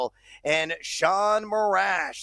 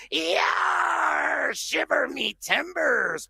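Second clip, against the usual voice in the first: pirate voice; 9 semitones higher, much slower than usual. Acted. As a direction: yell it like a pirate. Pirate catchphrase.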